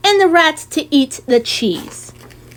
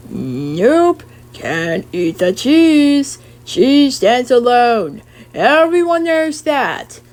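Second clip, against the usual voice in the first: farmer voice; much slower than usual. Acted. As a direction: drawl it like a plain old farmer. Farmer voiced, slow and low.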